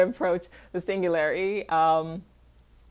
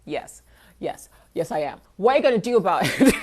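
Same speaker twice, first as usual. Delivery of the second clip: playful taunting; 7 dB louder than usual. Retold or imitated. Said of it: "my response was like".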